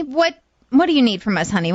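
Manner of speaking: normal talking voice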